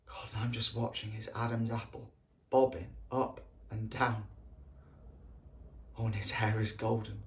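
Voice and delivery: low, soft, halting reminiscence; tender longing. Acted. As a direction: linger softly over each detail, with pauses. Recalling longing for sleeping friend.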